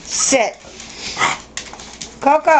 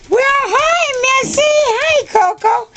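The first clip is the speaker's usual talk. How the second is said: very high sing-song hello to the dogs; pet voice; slower than usual. Real, not acted. Owner greeting her dogs Missy and Coco, high cooing pet voice. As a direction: coo up high like greeting puppies.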